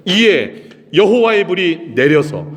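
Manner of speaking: high, loud, drawn-out declamation; dramatic scripture reading. Acted. Preacher reading how the Lord's fire fell, dramatic declamation. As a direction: proclaim the verse with slow weight.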